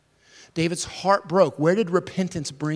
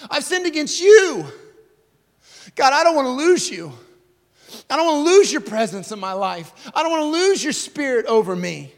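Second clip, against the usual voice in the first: high, loud pleading cry; anguished prayer at full force; 8 semitones higher, 7 dB louder than usual. Acted. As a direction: cry out to God desperately. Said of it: "He cries out".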